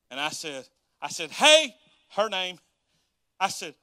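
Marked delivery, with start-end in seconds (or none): 1.3-2.6 sharp loud call down the hallway; retold yell. Calling out to his manager down the hall, loud yell. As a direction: shout her name across the office. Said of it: "and I yelled at her".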